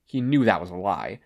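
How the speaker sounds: usual speaking voice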